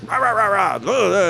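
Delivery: high-pitched mock soldier gibberish; barking soldier. Soldier checking a foreigner's passport, mock barking gibberish. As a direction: imitate incomprehensible barking in nonsense syllables. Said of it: "barks at me".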